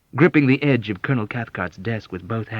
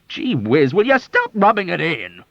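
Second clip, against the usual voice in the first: much higher, louder whiny protest; aggrieved cry; 10 semitones higher, 8 dB louder than usual. Acted. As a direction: cry out in wounded irritation. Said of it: "Colonel Cathcart cried".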